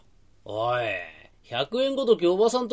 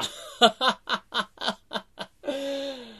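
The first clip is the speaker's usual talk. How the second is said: dim laugh; 7 semitones higher, 3 dB quieter than usual. Acted. Dim friend gets the joke.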